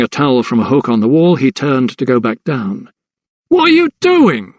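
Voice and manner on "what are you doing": very high shout after him; alarmed cry. A narrator's reading. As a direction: call him back in alarm. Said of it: "cried the head keeper after him".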